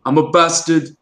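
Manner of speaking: northern English cosplay catchphrase; mock northern English, vowels pushed; Jon Snow accent. Shown as a demonstration. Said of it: "this is a great sentence to be able to say".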